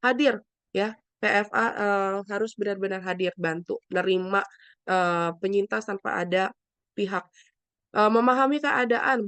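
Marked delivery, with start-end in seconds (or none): none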